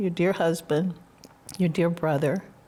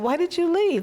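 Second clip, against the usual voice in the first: mock indignant question; 12 semitones higher, 3 dB louder than usual. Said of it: "I asked them".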